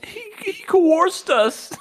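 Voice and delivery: very high, loud comic wail; slow, drawn-out fake-tearful squeal; mock squealing accusation. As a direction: shriek it in hammed-up distress. Mock outburst after acted complaint.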